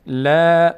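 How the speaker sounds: "لا" with a light, unrounded long vowel; thin light vowel. Shown as a demonstration. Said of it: "آ پر نمیشه".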